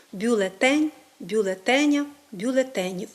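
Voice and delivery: бюлеТЕНЬ, бюлеТЕня, бюлеТЕнів, full е kept; correct stress and vowel. Shown as a demonstration. Teacher modelling correct forms, stress on ТЕ. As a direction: put the beat on ТЕ in each form. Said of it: "тому правильно говорити".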